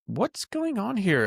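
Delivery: high, drawn-out questioning; puzzled alarm. Retold or imitated. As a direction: imitate a bookkeeper baffled by the books. Bookkeeper finds trouble in books.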